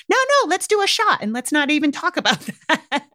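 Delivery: high-pitched, laughing brush-off; mock family dismissal. Voicing family brushing off the problem.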